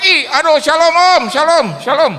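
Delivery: loud, high flustered shalom; flustered pious greeting. Retold or imitated. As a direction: scramble to act holy. Guest switches to pious. (salam saleh yang gugup)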